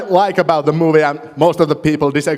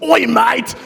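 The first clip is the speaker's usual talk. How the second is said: high-pitched mock Australian accent; 7 semitones higher than usual. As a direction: put on a broad imitation Australian accent. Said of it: "doing an Australian accent".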